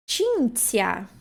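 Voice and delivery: "Cinzia" said CHIN-tsia; Italian ci and zi. Shown as a demonstration. Teacher introduces the last word, careful pronunciation. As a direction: open "Cinzia" on ch, then ts. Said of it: "C I e depois o Z I".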